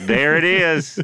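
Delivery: high, loud gotcha call; mock triumphant cry. Everybody in the world spotting the tell, mock triumphant cry. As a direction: belt it out as a shared discovery. Whole world catching the hesitation.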